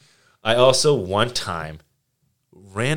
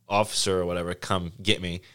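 ordinary delivery

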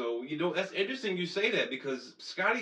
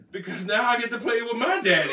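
put-on higher, laughing childish boast; mocking kid voice; 7 semitones higher, 5 dB louder than usual. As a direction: brag like a proud little kid. Voices Pippen Junior mockingly.

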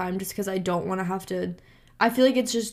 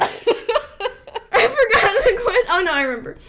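high, slow words through laughter; laughing; 13 semitones higher, 6 dB louder, slower than usual. Real, not acted. Laughing fit continues.

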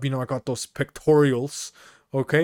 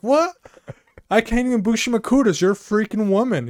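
confused protest; 8 semitones higher, 3 dB louder than usual. Retold or imitated. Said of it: "I was like".

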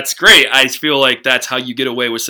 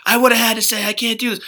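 breathy scared voice; 8 semitones higher, 5 dB quieter than usual. Retold or imitated. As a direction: whine it out, timid and breathy. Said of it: "with her breathy voice".

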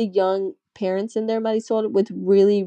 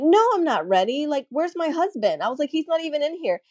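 higher, imitating her panicked protest; mock-frantic, pitched-up plea for husband; retold panic; 8 semitones higher than usual. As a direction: replay the drugged panic in the OR, pitched up.

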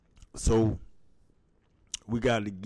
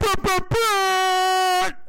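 slow, high, loud horn imitation; dancehall horn; 7 dB louder, much slower than usual. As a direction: blare it like an air horn.

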